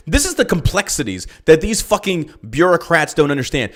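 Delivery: ordinary talk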